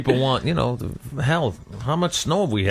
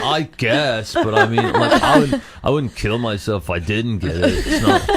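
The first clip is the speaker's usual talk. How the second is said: surfer voice. Retold or imitated. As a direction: shrug it off like a beach bum. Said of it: "I love the surfer voice".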